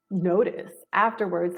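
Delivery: ordinary talk, nothing marked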